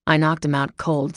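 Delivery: ordinary delivery, nothing marked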